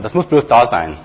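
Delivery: ordinary delivery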